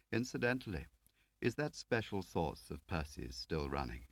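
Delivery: ordinary talk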